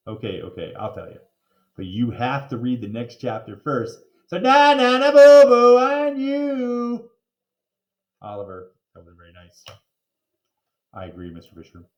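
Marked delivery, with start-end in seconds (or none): 4.3-7.1 high, loud slow na na boo boo; taunting. A narrator's reading. Narrator teasing the reader, high sing-song taunt. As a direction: tease the reader. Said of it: "taunting reader".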